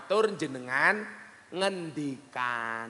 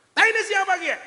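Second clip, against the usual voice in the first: much higher, much louder shriek; full yell; 10 dB louder than usual. Retold or imitated. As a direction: scream it as if you just stepped in it. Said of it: "Teriak dia". (orang ngapak nginjak kotoran, bengok banter)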